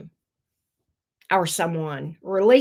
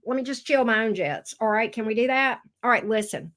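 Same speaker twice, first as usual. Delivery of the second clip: usual speaking voice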